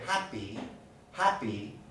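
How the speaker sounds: HAPPY, middle P released without a puff; no burst of air in the P of happy; unaspirated P. Shown as a demonstration. Teacher proving the point, unaspirated P in happy. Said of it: "there's no burst of air".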